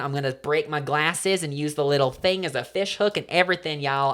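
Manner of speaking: folksy country bragging; country accent. Pretend. Bragging to co-host about fishing, country accent. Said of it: "We're from the country".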